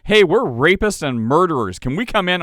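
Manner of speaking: hammed-up breezy voice of criminals knocking at the door; mock brazen criminal. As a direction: play a villain asking in as if it were nothing. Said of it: "If they're like".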